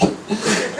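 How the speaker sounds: a quick laugh at yourself; amused laugh at own bad luck; short real laugh. Real, not acted.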